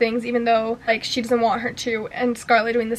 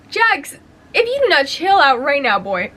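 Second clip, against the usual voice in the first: playful scolding; 3 semitones higher, 3 dB louder than usual. Acted. Reader telling a swoon-worthy book villain off, playful scolding. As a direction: scold him like an overwhelmed fan.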